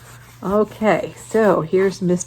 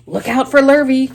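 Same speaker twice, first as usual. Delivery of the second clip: higher, louder warning call; the cows warn Wilbur, higher and louder; 6 semitones higher, 6 dB louder than usual. Acted. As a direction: warn him across the yard. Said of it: "called the cows".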